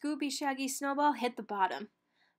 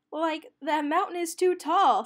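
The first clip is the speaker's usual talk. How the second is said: higher, louder Shaggy; lightly voiced cartoon slacker; 6 semitones higher, 8 dB louder than usual. A narrator's reading. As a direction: play the jittery hungry Shaggy. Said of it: "said Shaggy".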